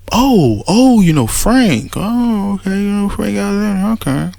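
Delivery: a sarcastic "oh, you know Frank"; mocking family. Family mocking RJ's claim.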